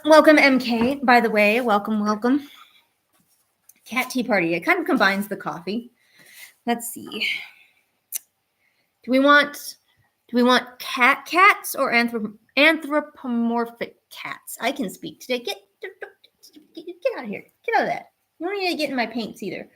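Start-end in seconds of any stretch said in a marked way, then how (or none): 0.0-2.5 light up for a new viewer; excited greeting. Real, not acted. 15.4-19.4 high pitched, soft shooing; shooing the cat. Real, not acted. Streamer shoos cat from paints, much higher.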